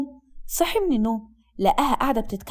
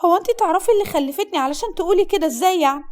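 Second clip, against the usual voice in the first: high, indignant female voice; offended young bride; 5 semitones higher, 3 dB louder than usual. Acted. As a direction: challenge the insult in a high voice.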